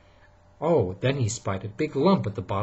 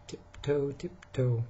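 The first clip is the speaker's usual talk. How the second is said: sneaking; 3 semitones higher, 6 dB quieter, slower than usual. Acted. Sneaking up quietly.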